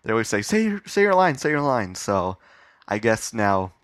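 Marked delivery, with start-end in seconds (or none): 0.4-1.9 higher, urging fan voice; mock eager fans. Fans asking for his catchphrase, eager. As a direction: pipe up like a pestering fan.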